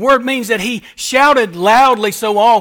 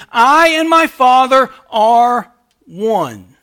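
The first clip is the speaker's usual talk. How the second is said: slow and louder; plain emphatic statement; 6 dB louder, much slower than usual. Acted. Preacher quoting Jesus to the congregation, slow and firm. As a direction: say each word firmly and plainly. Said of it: "he'll say it again very plainly".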